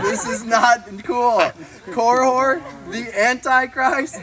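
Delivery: high, loud, drawn-out indignation; mock outrage. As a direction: confront your parents in comic disbelief. Acted confrontation with his parents.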